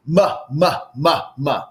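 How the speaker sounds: "Ma, ma" sounded forward in the mouth; voice placed in the mouth. Shown as a demonstration. Leading a group warm-up, voice in the mouth. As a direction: place the "ma" right in your mouth. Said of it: "putting our voice in our mouth".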